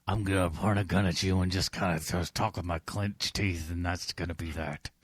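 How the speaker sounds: low, soft growl through clenched teeth; mock cop accent. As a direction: play a grumbling movie cop threatening someone. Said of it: "Oh, do my cop accent".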